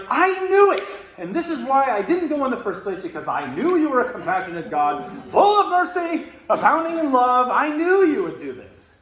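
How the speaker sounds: shouted high and loud, furious; angry Jonah rant. Retold or imitated. Jonah ranting at God for showing mercy, furious shout. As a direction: rant like a child who got his way denied. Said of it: "he's so angry".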